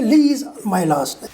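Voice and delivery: "Lee is" as "Lee's"; reduced form. Shown as a demonstration. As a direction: contract "Lee is" into one beat. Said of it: "No, he'll say".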